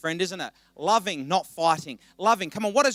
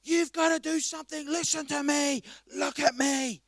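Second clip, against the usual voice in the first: imitating angry player; 4 semitones higher, 3 dB quieter than usual. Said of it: "he starts yelling and freaking out".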